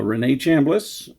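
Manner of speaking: ordinary delivery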